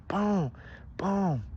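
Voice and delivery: loud, high, falling booms; punch sound effects. A narrator's reading. Narrator voices repeated punches, loud booming impacts. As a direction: land each boom like a blow. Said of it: "He keeps getting punched".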